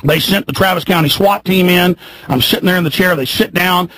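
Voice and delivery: ordinary talk, nothing marked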